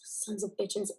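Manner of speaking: very soft, bitter cursing; muttered curse. Acted. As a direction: curse under your breath. Cursing under breath after bad news.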